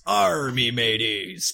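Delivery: slow, loud pirate call. Retold or imitated. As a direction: bellow it like a pirate captain. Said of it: "a big pirate goes".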